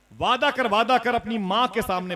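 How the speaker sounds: loud, high-pitched furious demand; angry aunt. A narrator's reading. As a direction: act out an enraged aunt demanding a promise. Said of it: "मेरी खाला बड़े गजब में थी, बड़ी नाराज थी". (गुस्से में खाला की ऊँची आवाज़)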